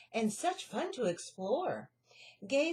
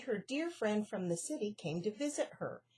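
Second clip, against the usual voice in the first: ordinary delivery, nothing marked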